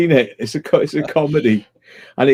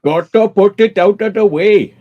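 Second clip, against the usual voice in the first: mocking Welsh accent; 6 semitones higher, 5 dB louder than usual. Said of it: "That wasn't an Indian voice... That was a Welsh voice".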